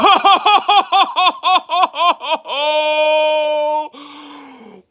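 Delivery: high, giddy burst of real laughter; excited laughter. Real, not acted. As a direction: laugh out in disbelief. Pulls a rare card.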